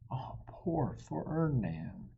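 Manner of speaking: plain reading voice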